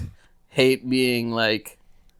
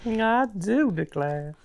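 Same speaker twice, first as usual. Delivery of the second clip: put-on Southern belle accent; 8 semitones higher, slower than usual.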